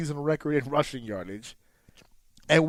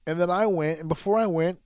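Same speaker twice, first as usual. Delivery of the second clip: normal talking voice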